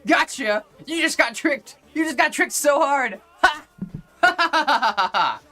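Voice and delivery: high, mocking gloat with a cackling laugh; gloating gotcha. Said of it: "And I'm like".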